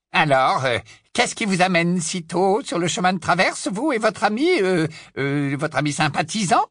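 lightly voiced suave Death Eater; a hint of a smug wizard; Travers voice.

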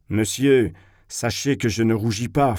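ordinary delivery, nothing marked

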